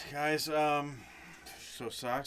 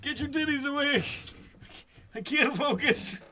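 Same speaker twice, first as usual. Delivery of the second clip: put-on high, creaky lecherous old man. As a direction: whine it like a flustered old geezer. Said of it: "like the old dude from, uh, Family Guy".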